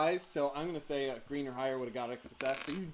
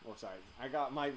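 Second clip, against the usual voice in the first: ordinary delivery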